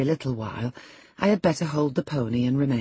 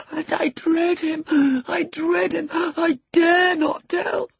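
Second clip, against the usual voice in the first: very high, breath-broken cry; gasping terror; 13 semitones higher, 4 dB louder, slower than usual. A narrator's reading. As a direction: gasp it out, rising to a slow, panicked cry. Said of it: "gasped the boy".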